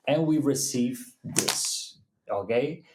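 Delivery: normal talking voice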